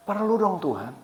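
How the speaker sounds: gently insist, as if objecting in prayer; quiet mock-plaintive objection; retold protest. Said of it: "Terus saya bilang".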